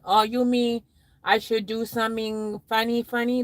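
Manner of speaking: ordinary delivery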